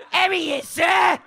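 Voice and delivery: high, yelped mock Australian accent. Shown as a demonstration. Comic showing off his Australian accent, high and hammed-up. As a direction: call it out in an exaggerated Australian twang. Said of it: "That's my Australian accent".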